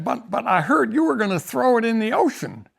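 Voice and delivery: stammering high-pitched chief; imitating Jim. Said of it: "that's the way he talked".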